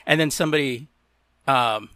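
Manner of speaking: usual speaking voice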